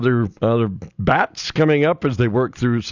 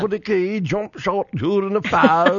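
play-by-play call; 7 semitones higher than usual. Shown as a demonstration. Show-off play-by-play for co-hosts. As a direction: call the shot like a courtside announcer. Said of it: "The play-by-play".

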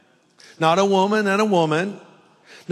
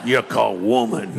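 playful imitation of a Southern speaker; Southern accent; 4 semitones lower than usual. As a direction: recite it in a put-on Southern accent. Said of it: "like he was from the South".

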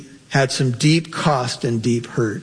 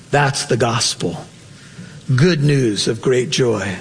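ordinary talk

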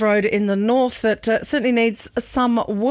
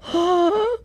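mock gasp; 11 semitones higher than usual. Mock shock at being teased.

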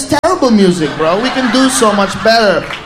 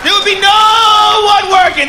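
higher, louder and slow; slow shout; 8 semitones higher, 4 dB louder than usual. Real, not acted. To the audience, a slow shouted punchline. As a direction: hammer home that no one would work. Rant about pot and jetpacks.

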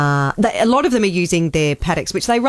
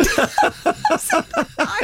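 high squealing laugh; big laugh. Real, not acted. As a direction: let out a high cackle of laughter. Laughing at lady's reply.

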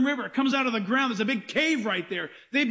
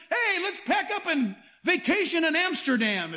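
higher-pitched, mock-eager holiday pitch; mock enthusiasm; 5 semitones higher than usual. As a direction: pitch an absurd holiday as a treat. Voices an absurd family vacation suggestion.

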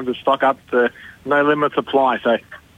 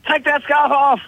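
mock yell, pitched much higher; yelling stranger; 12 semitones higher, slower than usual. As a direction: imitate a guy shouting across the river path. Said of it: "this guy yells at me".

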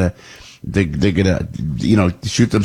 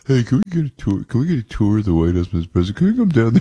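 higher, pleading grovel; 5 semitones higher, faster than usual. Acted. Host begging the president for a tour, grovelling. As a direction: wheedle in a put-on grovelling voice. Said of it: "That's my grovel voice".

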